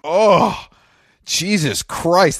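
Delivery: high drawn-out mock horror; slow, appalled exclamation; shocked groan. Real, not acted. Reacting to Del Taco run.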